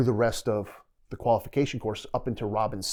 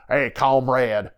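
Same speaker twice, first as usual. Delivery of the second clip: drawn-out, louder fake Russian accent; lightly faked Russian greeting, slow; mock Russian accent; 5 dB louder, much slower than usual. Georgia guy posing as captor to prisoner, mock Russian accent. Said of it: "some guy from Georgia with a Russian accent".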